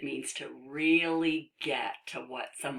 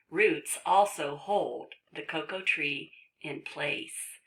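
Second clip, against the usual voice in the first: ordinary delivery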